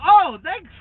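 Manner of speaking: high loud sarcastic thanks. Retold or imitated. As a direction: thank him with heavy sarcasm. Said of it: "And I'm like".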